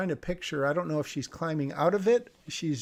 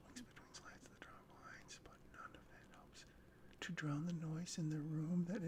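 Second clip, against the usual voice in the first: faint breathy whisper; whispered read; 17 dB quieter than usual. Acted. Reviewer whispers the hangover line. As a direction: barely voice the words.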